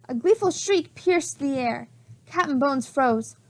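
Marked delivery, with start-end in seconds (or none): none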